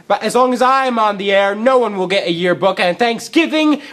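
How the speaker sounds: triumphant evil vow; villainous declaration. Acted.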